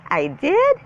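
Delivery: high, loud surprised question; startled high-pitched query. A narrator's reading. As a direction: jump up high in disbelief. Pupil surprised by teacher's praise.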